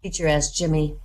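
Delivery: ordinary talk, nothing marked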